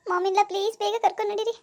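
high, strained voice crying out in pain; moaning in pain, pleading with her mother-in-law. Acted. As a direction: beg for help through stomach cramps. Acted stomach pain, pleading.

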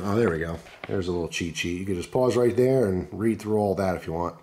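normal talking voice

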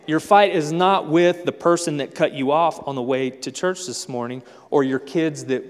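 normal talking voice

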